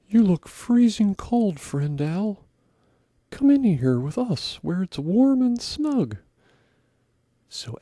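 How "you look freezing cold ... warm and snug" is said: louder, higher friendly squirrel. Acted. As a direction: call him in like a lively squirrel. Squirrel invites the owl.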